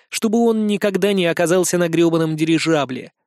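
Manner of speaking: ordinary delivery, nothing marked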